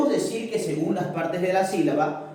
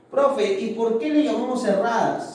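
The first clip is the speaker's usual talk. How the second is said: lightly voiced student, higher pitch; imagined student; 5 semitones higher than usual. Student asking teacher why they are called closed, lightly acted higher voice. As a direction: pipe up as a pupil with a question.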